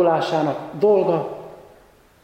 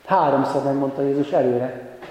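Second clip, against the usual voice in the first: ordinary talk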